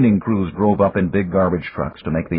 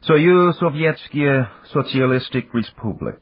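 slow, higher, badly accented Russian; awful Russian accent; 7 semitones higher than usual. A narrator's reading. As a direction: read out Russian in a clumsy accent. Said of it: "That's an awful accent you've got".

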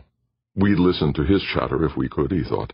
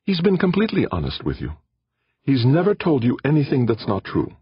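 high, earnest protest; insistent Aditi; 11 semitones higher than usual. A narrator's reading. Aditi insists to Jordan, high and earnest. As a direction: insist he was honest.

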